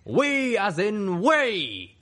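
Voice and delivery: very slow, high, booming proclamation; blasting royal voice. A narrator's reading. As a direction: thunder each word, stretched out. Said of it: "the Canterlot Ghoul blasted".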